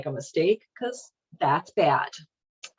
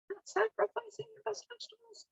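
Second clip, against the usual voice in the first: much higher, softer squeaky disbelief; a hint of disbelief, pitched way up; incredulous; 11 dB quieter, faster than usual. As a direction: ask it in mock shock, way up high. Mock incredulous question.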